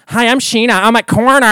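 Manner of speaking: high, loud put-on reality star voice; Sheena impression. Comic doing the Sheena bit for the audience, high and loud. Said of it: "And then I do that".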